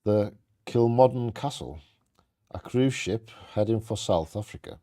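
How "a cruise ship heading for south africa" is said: ordinary talk, nothing marked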